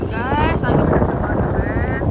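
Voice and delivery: loud call into strong wind; shouting over wind. Real, not acted. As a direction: raise your voice above the gusts. Lagi kenceng banget.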